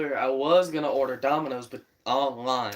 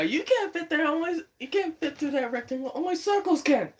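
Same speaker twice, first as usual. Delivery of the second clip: high, cartoonish voice; high cartoon character voice; Family Guy bit. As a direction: squeak it out like a cartoon. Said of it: "So I got a family guy".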